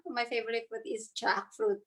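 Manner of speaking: ordinary talk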